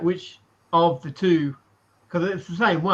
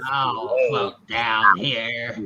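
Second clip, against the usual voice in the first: creepy clown voice; 5 semitones lower, much slower than usual. Retold or imitated. Quoting Pennywise line.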